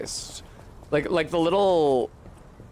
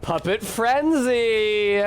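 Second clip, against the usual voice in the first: higher, slow, drawn-out exclaim; hammed-up excitement; 8 semitones higher, 3 dB louder, much slower than usual. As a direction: stretch it out like a silly battle cry. Mocking the game line.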